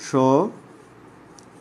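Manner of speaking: শ্মশান drawn out slowly, sho ... shan; syllable by syllable. Shown as a demonstration. Teacher spelling out for students, শ্মশান in slow separated syllables. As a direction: stretch the first syllable of শ্মশান, then pause. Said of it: "একটু লক্ষ্য করো".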